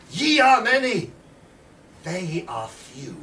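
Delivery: slow, loud, ringing declamation of verse; poem recital. Acted. Storyteller declaiming Shelley's verse to the room, slow and rousing. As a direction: proclaim the lines like a rallying cry, slowing to a hush at the end. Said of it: "which ends with the lines".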